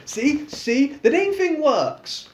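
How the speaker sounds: high, slow playful teasing; cheeky teasing. A narrator's reading. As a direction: tease with innocent glee. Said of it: "smiling innocently".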